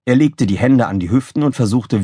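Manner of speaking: ordinary delivery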